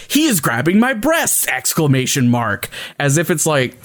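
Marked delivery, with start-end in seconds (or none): 0.1-1.5 higher-pitched mock alarm, reading it out; exclaimed on-screen caption. Host quoting the show's pop-up caption, mock exclaiming. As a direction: read the caption as a high exclamation. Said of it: "and it says".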